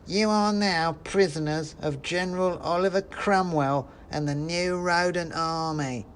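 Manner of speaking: high, slow squeaky soldier; a little rodent guard laying down the law; mouse soldier voice. Acted. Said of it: "he said".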